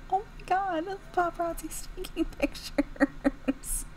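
laughing. Real, not acted. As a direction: laugh through the words. Laughs at gross scene.

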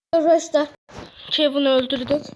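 loud, excited proclamation; triumphant announcement. Real, not acted. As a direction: shout the victory out to viewers. Announcing the kill to viewers. (heyecanla bağırarak ilan)

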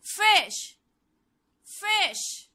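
ordinary talk, nothing marked

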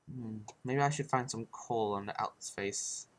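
ordinary talk

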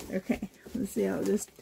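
ordinary delivery

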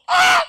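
piercing high scream of joy. Retold or imitated. The sister bursts into a run with a scream. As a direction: let out a sudden scream.

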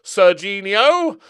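loud, stretched-out double take on the name; surprised call-out. Real, not acted. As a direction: stop and call out in disbelief.

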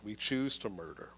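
normal talking voice

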